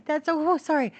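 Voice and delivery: a loud high burst of laughter, then oh oh sorry; startled laugh. Real, not acted. Startling her cockatiel with a sudden loud high laugh. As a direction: caught off guard, laughing out loud.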